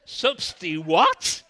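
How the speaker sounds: loud, slow, high "Substi what?"; stunned. Acted. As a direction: blurt it out in disbelief. Stunned by theology word.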